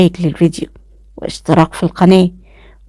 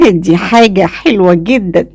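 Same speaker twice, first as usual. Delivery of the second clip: plain reading voice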